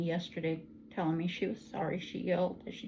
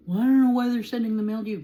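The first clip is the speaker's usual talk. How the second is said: loud, fast, higher indignant rant; mock angry daughter; 4 semitones higher, 11 dB louder, faster than usual. Mother imitating her daughter's angry complaint about mail. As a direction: rattle it off like an annoyed daughter.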